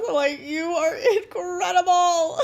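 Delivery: choked-up praise breaking into laughter; teary and emotional. Real, not acted. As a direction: push through real tears with a laugh. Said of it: "I'm getting emotional".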